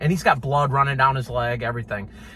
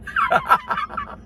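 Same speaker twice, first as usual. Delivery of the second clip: a burst of high real laughter; a sudden squealing laugh. Real, not acted. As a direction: crack up at the memory. Laughing at the story.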